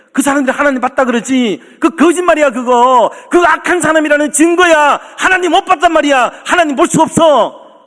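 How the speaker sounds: high, emphatic pulpit denunciation; forceful preaching. Acted. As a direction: drive each accusation home hard. Preaches forcefully against false claims. (목청 높여 단호하게 설교)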